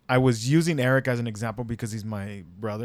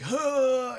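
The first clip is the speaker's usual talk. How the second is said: high, winded gasp of a huh; imitating winded brother, high pained huh; 11 semitones higher than usual. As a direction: mimic a kid with the wind knocked out. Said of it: "Eric's like".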